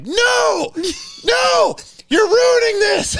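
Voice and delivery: high desperate yelling at the fighters; frantic shout. Retold or imitated. Filming a fight, yelling at the cop who broke it up. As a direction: scream at the guy breaking up the fight. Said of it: "I'm like".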